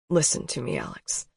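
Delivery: low, soft and full of feeling; low emotional voice. A narrator's reading. Colton speaking low and tender to Alex. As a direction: drop the voice, hold the emotion. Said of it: "his voice low and full of emotion".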